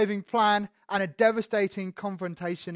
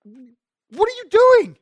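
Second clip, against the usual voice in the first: scandalised Pharisee, louder and higher each time; indignant grumbling; 6 semitones higher, 7 dB louder than usual. Retold or imitated. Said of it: "They're saying".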